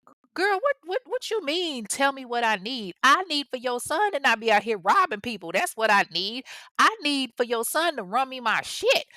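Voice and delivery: high-pitched indignant retort; sassy comeback. Pretend. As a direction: tell her off with full attitude. Imagined retort to the mother.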